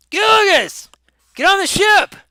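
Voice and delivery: high, loud yell across the water; captain yelling. Acted. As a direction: shout for him to hurry aboard. Captain yelling from departing ship.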